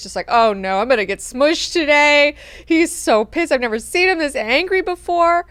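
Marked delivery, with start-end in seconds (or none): 0.3-2.3 loud, high mock dread; hammed-up fearful wail; terrified. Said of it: "He's terrified". 2.7-5.5 high, loud mock alarm; hammed-up fretting about an angry boss; terrified. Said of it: "He's terrified".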